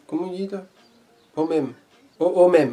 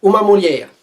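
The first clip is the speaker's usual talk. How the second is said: loud repeat after the app; 5 semitones higher, 14 dB louder than usual. Shown as a demonstration. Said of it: "répète après l'appli".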